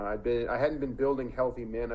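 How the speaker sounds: ordinary delivery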